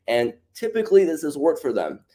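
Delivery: "typically" pitched high and set apart; stress on typically. Real, not acted. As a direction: lean on the word "typically". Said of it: "emphasis on the word typically".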